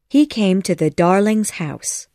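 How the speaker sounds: usual speaking voice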